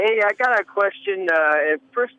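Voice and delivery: ordinary talk, nothing marked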